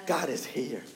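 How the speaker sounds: hushed and low, as the spirit speaking comfort; soft inner reassurance. Acted. Preacher voicing the spirit's calm answer, hushed and tender. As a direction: breathe it gently like a quiet inner voice.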